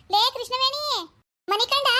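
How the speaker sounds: calling out. Acted. Mother-in-law calling daughter-in-law.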